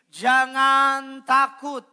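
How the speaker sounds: high, loud scripture declaration; ringing, slow-ending preacher's call; loud proclamation. Real, not acted. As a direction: proclaim it to the whole congregation, drawn out at the end. Leads congregation in proclaiming the verse.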